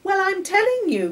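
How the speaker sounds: put-on very high, loud lecturing girl voice; bossy girl. A narrator's reading. Girl lecturing Charlie on toothache, bossy and loud. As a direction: tell him off, high and loud. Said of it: "continued Patricia".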